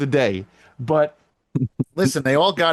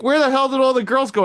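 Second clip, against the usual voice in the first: startled where-did-they-go shout; 11 semitones higher than usual. Retold or imitated. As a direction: call out across the field, baffled. Coach calling out for missing girls.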